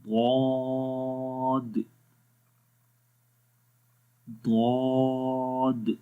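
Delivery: ضاد stretched out extremely slowly, heavy and full-mouthed; recited ḍād. Shown as a demonstration. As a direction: hold ضاد long with the voice rising to the palate. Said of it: "تو اس کو ہم پڑھیں گے ضاد". (ضاد کو لمبا کھینچ کر بھاری آواز میں پڑھیں)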